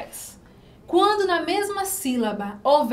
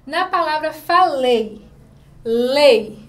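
lei said loud and held, much louder than the sentence around it; stressed syllable, louder; 7 dB louder, slower than usual. Shown as a demonstration. Teacher showing pupils the strong vowel in lei, loud. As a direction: belt out the syllable lei for the class. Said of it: "qual é a vogal que se apresenta mais forte?".

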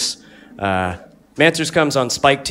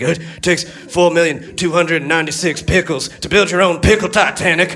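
monster truck ad; 7 semitones higher than usual. Retold or imitated. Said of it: "talking to yourself in his voice".

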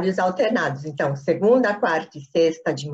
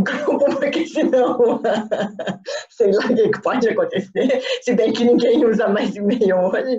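talking through real laughter, slightly higher; laughing; 4 semitones higher than usual. Real, not acted. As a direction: let the giggles carry into the words. Laughs at her own worry. (falando entre risadas)

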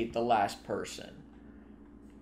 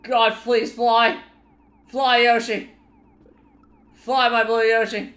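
pleading shout; 8 semitones higher, 7 dB louder than usual. Real, not acted. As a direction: beg desperately for it to work. Begging Yoshi to fly.

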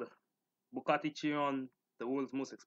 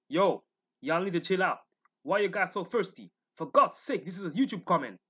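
loud scolding; 4 semitones higher, 6 dB louder than usual. Real, not acted. Scolding the thirsty commenters.

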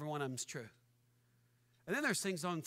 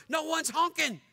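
normal talking voice

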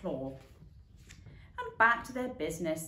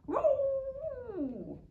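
a stuck cat yowling. A narrator's reading. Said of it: "the sound that echoed around".

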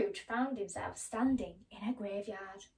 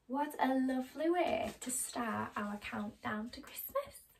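plain reading voice

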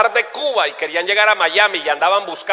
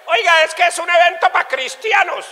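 high-pitched quoted voice; imitated objection; 11 semitones higher than usual. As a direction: lift it high as someone else talking. Voicing someone commenting on the event.

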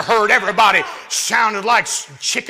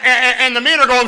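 ordinary delivery

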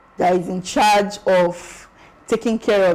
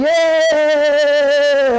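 long, high, loud imitated cheer; cheering crowd; 6 semitones higher, 5 dB louder than usual. Students hearing Blue House won the sanitation, imitated drawn-out cheer. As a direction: stretch it out like a shouting crowd. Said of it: "you hear everybody shouting".

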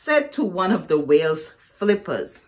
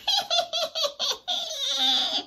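hyena laugh, high and cackling; high airy laugh. Acted. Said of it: "laughing airily".

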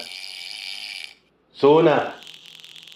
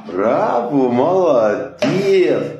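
higher, slow, stretched praise; bright praise; 4 semitones higher, 3 dB louder, much slower than usual. Real, not acted. Praising the pet after a trick, higher and drawn out. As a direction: cheer the pet on. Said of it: "coaching pet through trick".

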